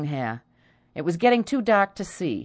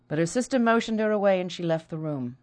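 ordinary delivery, nothing marked

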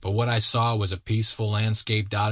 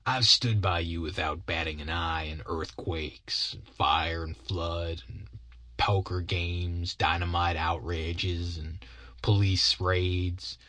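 slow, weary sidekick voice; long-suffering Bill; 2 semitones lower, slower than usual. Acted. Bill lists hardships shared with Sam, slow long-suffering voice. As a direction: count off each ordeal slowly, drawn out.

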